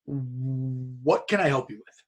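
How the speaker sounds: drawn-out, lightly voiced skeptical staffer; slow deadpan question. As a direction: let each word hang, unimpressed. Wrestling staffer questions eager stranger.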